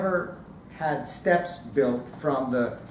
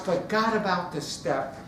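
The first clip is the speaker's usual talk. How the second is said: ordinary delivery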